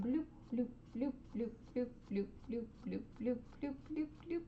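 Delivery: soft, evenly spaced little bloops; little popped "bloop" noises, one after another; bloop sound effects. Real, not acted. Painter making playful sound effects with each brush stroke, quiet bloops. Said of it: "you're enjoying the sound effects".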